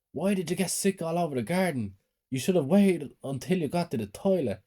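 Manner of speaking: high pitched scolding cry; screaming stepmother. A narrator's reading. Stepmother shrieking about the sick in the garden, angry. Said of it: "My stepmother started screaming".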